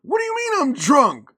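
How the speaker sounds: loud, high-pitched protest; mock indignant kid. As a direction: imitate a child disputing an accusation. Child protests to mom.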